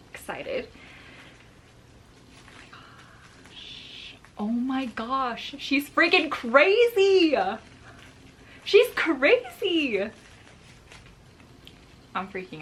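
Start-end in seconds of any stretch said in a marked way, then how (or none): 2.4-4.2 soft, slow whispered awe; real hushed disbelief; breathless whisper. Real, not acted. Awed while checking roots. 5.6-10.1 high, slow, emphatic amazement; drawn out in disbelief; real thrilled marvel; amazed. Real, not acted. Amazed at the plant.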